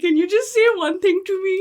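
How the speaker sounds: mock tearful plea.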